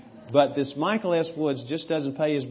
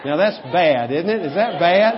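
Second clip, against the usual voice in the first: playful preaching; 5 semitones higher, 10 dB louder than usual. Real, not acted. Preacher teasing congregation loudly.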